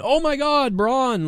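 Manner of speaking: high-pitched pretend shock; mock amazement. Host mimics a viewer fooled by the main event, mock amazement. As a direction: exclaim high, as a hyped fan would. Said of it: "just to make you think".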